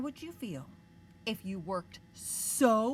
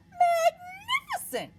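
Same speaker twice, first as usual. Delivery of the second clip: much higher, loud and drawn out; excited praise; 9 dB louder, slower than usual. Acted. Princess cheering the dragon on, high and drawn out. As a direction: gush with wonder.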